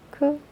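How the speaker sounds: 크 with a strong puff of air; aspirated k in 크; aspirated consonant. Shown as a demonstration. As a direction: release 크 as an aspirated sound. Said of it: "거센 소리".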